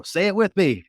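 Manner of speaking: high, loud invitation to join; rally the crowd to chant along; rallying call. Retold or imitated. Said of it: "And I'll go".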